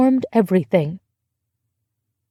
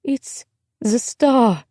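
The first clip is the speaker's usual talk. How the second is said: put-on slow, higher girl's voice, close to sobbing; barely managed, tearful; 4 semitones higher, much slower than usual. A narrator's reading. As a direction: struggle to get it out through tears. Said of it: "Charlotte managed".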